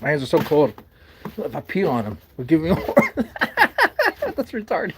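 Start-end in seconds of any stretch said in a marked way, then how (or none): 2.8-4.3 squealing high-pitched laugh. Real, not acted. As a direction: burst into a high giggle. Laughing at pee idea.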